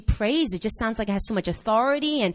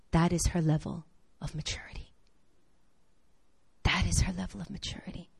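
whispered, heartbroken realization; sad whisper; 5 semitones lower, 10 dB quieter than usual. Retold or imitated. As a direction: let it out as a whisper of grief. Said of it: "And I was just so sad".

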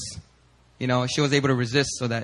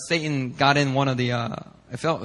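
ordinary delivery, nothing marked

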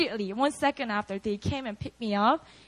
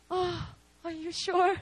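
hesitant; 5 semitones higher, 4 dB quieter than usual. Retold or imitated. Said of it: "I was like".

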